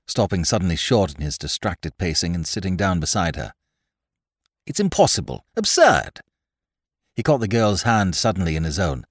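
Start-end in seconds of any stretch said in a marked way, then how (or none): 4.7-6.2 high-pitched, emphatic disbelief; exclaimed refusal to believe; incredulous protest. A narrator's reading. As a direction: reject it outright, pitch leaping up. Distracted detective refuses her confession.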